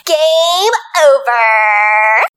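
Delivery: drawn-out game over; Game Girl. Retold or imitated. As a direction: stretch it out slow. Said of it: "things that I say, such as".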